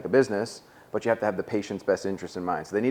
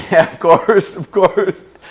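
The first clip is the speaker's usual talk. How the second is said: laughing through a higher, louder, slow yeah of course; 10 semitones higher, 11 dB louder, much slower than usual. Real, not acted. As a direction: agree while cracking up. Laughing at host's joke.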